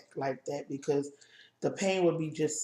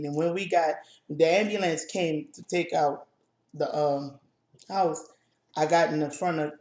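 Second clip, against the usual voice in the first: normal talking voice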